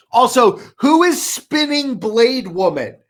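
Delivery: loud, high, insistent questioning; excited demanding. Real, not acted. Co-host asks who the mystery trailer character is, excited demanding.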